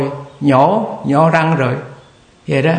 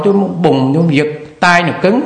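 usual speaking voice

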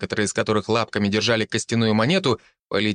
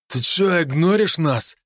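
russian street thug; 7 semitones higher than usual. Acted. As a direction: snarl it like a street punk. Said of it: "рыкнул кучерявый шатен".

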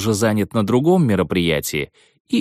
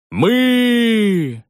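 crowd yelling; 12 semitones higher than usual. Retold or imitated. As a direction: shout it like a crowd. Crowd answers.

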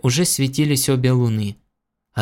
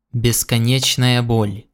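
ordinary delivery, nothing marked

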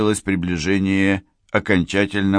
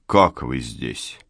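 lower, slow man's voice for the colonel; slow, low, a puzzled officer; colonel's voice; 6 semitones lower, much slower than usual. Acted.

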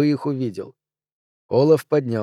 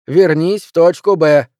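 louder, slow firm order; insistent; 4 semitones higher, 7 dB louder than usual. Acted. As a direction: press the order home slowly. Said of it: "настаивал Артур".

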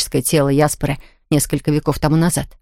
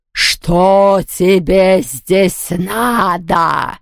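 very slow, drawn-out menacing voice; hissing ghost; much slower than usual. A narrator's reading. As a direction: drag out the question like a hissing ghost. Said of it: "шипела она".

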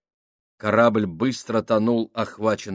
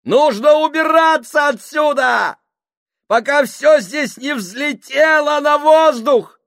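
high, loud yell over chaos; shouting; 9 dB louder than usual. A narrator's reading. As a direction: scream it over the fire. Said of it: "заорал Джордж".

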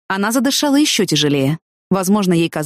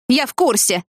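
high, angry retort; mildly barked, pitched up without extra volume; snapping; 6 semitones higher than usual. A narrator's reading. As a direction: cut him off angrily. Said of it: "рявкнула она".

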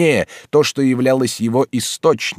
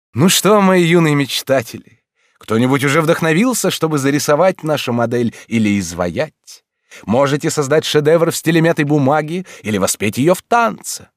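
high, beaming odd teacher; eccentric teacher voice; 7 semitones higher, 4 dB louder than usual. A narrator's reading. Lorenz voiced by narrator. (чудаковатый учитель)

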